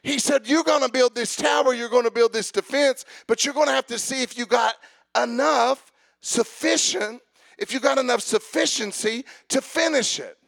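rising to a full preaching cry. Acted. Preacher to congregation, building to a fervent shout.

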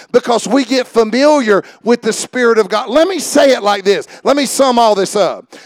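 ordinary talk

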